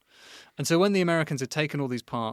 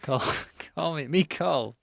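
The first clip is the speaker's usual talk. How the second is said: put-on goofy Scottish accent, louder and higher; sudden Scottish accent; 5 semitones higher, 3 dB louder, slower than usual. Joking about a man named Karl Meat, Scottish accent. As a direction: slip into a jokey Scottish accent mid-laugh. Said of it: "don't know why I've gone Scottish".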